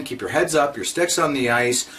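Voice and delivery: normal talking voice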